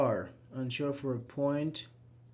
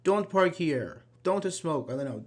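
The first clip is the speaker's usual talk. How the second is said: ordinary delivery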